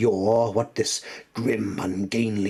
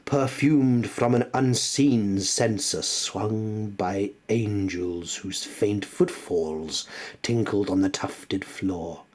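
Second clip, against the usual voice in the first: usual speaking voice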